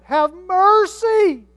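long, high, very loud cry; crying out for mercy. Acted. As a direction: wail it out, drawing out each word. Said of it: "He cried out".

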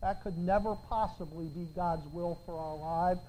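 ordinary talk, nothing marked

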